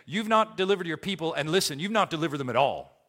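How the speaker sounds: fast, insistent stress on "not"; emphatic accusation. Acted. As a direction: press "not delivered" as a complaint to God. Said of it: "It's emphatic. So it's Moses saying".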